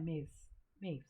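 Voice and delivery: "मेज" slow and soft, the middle vowel a faint schwa; slow schwa model. Shown as a demonstration. Teacher slowly models the reduced vowel for students. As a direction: stretch out "मेज" gently, swallowing the a. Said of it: "तो क्या पढ़ना है".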